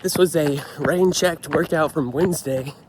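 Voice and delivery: ordinary talk, nothing marked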